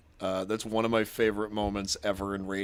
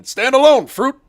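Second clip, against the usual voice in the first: barked standalone fruit, much higher and louder; drill sergeant; 17 dB louder than usual. Shown as a demonstration. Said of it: "a drill sergeant would yell that at you".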